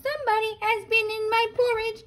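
put-on squeaky fast baby bear complaint; Baby Bear wailing. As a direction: tumble the words out like a tiny upset bear. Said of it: "said Baby Bear".

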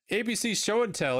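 "A B C Show and Tell" announced high and bright like an ad; ad read. Acted. Reads the game's ad blurb.

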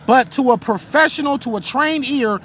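ordinary talk

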